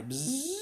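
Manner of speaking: rising loud buzz as it dives; high diving buzz. Acted. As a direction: zoom down with a whine. Mosquito dives.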